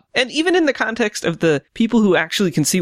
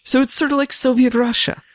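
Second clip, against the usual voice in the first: put-on Russian accent; 7 semitones higher than usual. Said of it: "the third cast I've done a Russian accent on".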